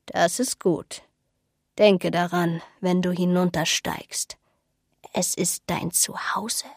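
low-key, breathy, eerie counsel; hushed old woman giving a cryptic warning; mysterious old seer. Acted. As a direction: speak softly and ominously, slowing at the end.

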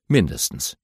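plain reading voice

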